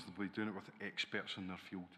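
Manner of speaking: ordinary talk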